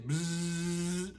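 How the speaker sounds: quiet bee buzzing; soft bee buzz. Shown as a demonstration. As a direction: whisper a buzz. Models bee sound.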